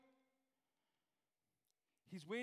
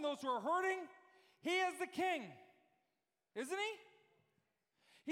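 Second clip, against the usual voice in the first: high, emphatic sermon delivery; impassioned preaching; 11 semitones higher, 6 dB louder than usual. Real, not acted. Preacher declaring to the congregation who makes him righteous, impassioned preaching. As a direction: proclaim it to the whole church.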